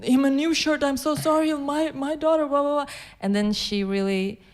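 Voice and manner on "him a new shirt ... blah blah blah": mom's voice shoots high, gushing apologies; flustered apologetic mom. Retold or imitated. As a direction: play the embarrassed mother, sorry to the neighbour. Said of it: "And my mom was like".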